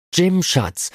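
high, pleading call with a touch of sobbing; tearful cry. A narrator's reading. Della running to Jim, calling him tearfully and high. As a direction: cry his name out near tears. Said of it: "rief sie laut schluchzend".

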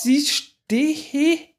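high, breathless excitement; aufgeregt. Real, not acted. Rakete steht auf dem Schiff. (ungläubig begeistert, hoch)

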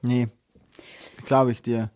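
ordinary delivery

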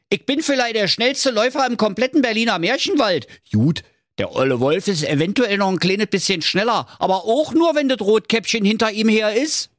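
very high-pitched, cocky Berlin hare; boastful hare. Acted. Hare bragging to the hedgehog about his running, boastful.

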